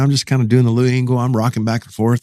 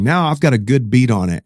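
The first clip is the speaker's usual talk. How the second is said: ordinary talk, nothing marked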